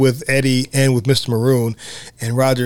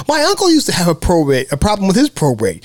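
Roger's voice; 10 semitones higher than usual. Retold or imitated. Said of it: "And Roger's like".

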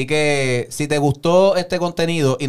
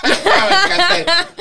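high squeal of delight through laughter; laughing shout; 6 dB louder, much slower than usual. Real, not acted. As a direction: burst out laughing it. (grito de alegría entre risas)

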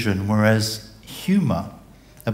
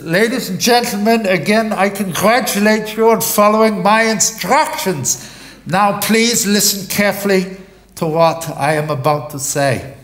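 much higher, louder loudspeaker announcement, laughing through it; captain in a boat addressing the stranded passengers; 9 semitones higher, 5 dB louder than usual. Acted. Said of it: "he has a loudspeaker".

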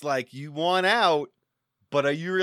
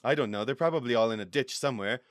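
throwaway aside; 7 semitones lower, faster than usual. Acted. Quick mumbled aside.

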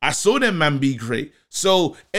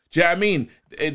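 ordinary talk

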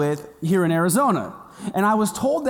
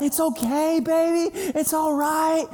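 mom calling; 10 semitones higher than usual. Retold or imitated. Mom answering her scared son, high reassuring call. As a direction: imitate a reassuring mother from afar. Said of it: "I hear my mom".

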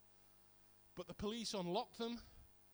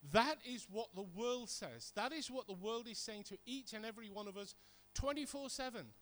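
usual speaking voice

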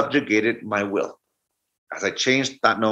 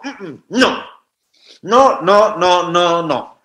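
put-on high, louder childish refusals; stubborn child; 6 semitones higher, 5 dB louder, much slower than usual. Boy refusing grandmother's spoon, high and stubborn. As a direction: clamp shut and refuse like a kid.

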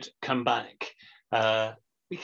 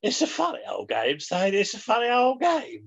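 lightly done football pundit voice, rising on the repeat; mock a TV pundit, barely changed voice; Greavesy impression; 8 semitones higher than usual. Co-host doing a light Greavesy impression for the podcast. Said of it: "I did an impression of you".

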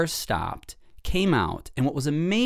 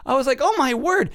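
retold shock; 9 semitones higher than usual. Host recalling her astonishment at the injured dog walker. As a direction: relive the astonishment.

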